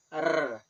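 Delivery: "R" as a long tongue trill, rrr; rolled R. Shown as a demonstration. Teacher showing the sound in carro, rolled R. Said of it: "When you roll the R".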